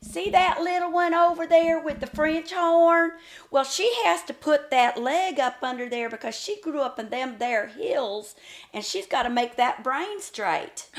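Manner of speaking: loud, high, hammed-up hillbilly ribbing; mocking band director. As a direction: imitate a teacher mocking a hillbilly kid before the whole band. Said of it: "And he goes".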